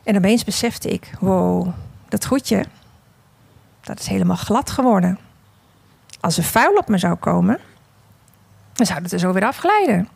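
unhurried storytelling voice, read as the clay vase; story narration. A narrator's reading. Preacher telling the congregation a parable, in storytelling voice.

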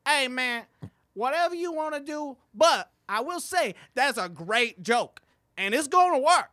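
high, loud put-on comic voice; loud high impression praising the joke; impression of fellow comic. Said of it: "He was like".

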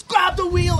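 high, loud panicked yell; panicked shout. Retold or imitated. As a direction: shout it like you're losing control of the car. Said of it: "And he goes".

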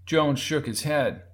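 ordinary delivery

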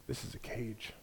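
very soft, barely above a breath; hushed serpent voice. Acted. Serpent leaning in close to Eve, very softly. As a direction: let it sink to almost nothing. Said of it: "the Hajj leans in and he says".

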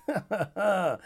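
short falling laugh; self-amused laugh. Real, not acted. Laughs after telling friends his resolve, amused chuckle. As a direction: laugh at your own point.

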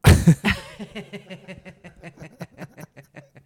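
long high laugh. Real, not acted. Laughing at own story.

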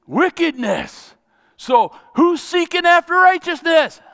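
loud, high, calling out to the congregation; rousing preacher. Acted. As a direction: fire up the congregation.

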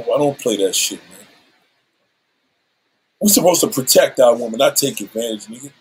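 normal talking voice

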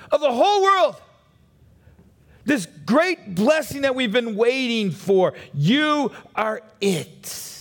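preacher swelling to full force; building sermon. Acted. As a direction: build the proclamation toward a peak.

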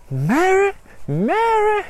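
very high, rising call; mad wife. Acted. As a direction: call out like a deranged mother, high-pitched. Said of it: "mad wife".

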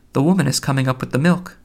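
ordinary delivery

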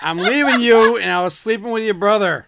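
loud, laughing mock confession; mock breakup announcement. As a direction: declare it like a soap opera twist. Acting out the breakup text.

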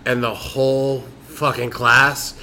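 normal talking voice

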